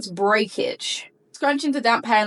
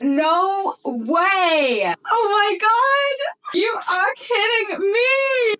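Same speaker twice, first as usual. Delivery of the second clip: much higher, slow cries of disbelief; shocked delight; 10 semitones higher, much slower than usual. Real, not acted. Seeing the whole product set inside, much higher shocked cries. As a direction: cry out like you can't believe your luck.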